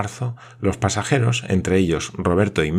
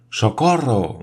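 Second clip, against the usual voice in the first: a lightly raised call for help; mild narrated cry; 4 semitones higher, slower than usual. A narrator's reading. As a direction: read the boy's cry a little higher, not full force. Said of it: "gritó éste".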